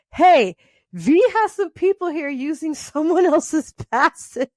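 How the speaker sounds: high quoted phone line, breaking into a laugh; quoted call, laughing. Retold or imitated. Retelling the guest services call while laughing. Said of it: "she gets a call from".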